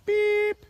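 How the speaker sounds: one high, sharp beep; a single stop blast on the whistle; lone piping whistle beep. Shown as a demonstration. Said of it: "if I wanted to stop them".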